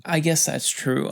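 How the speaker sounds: normal talking voice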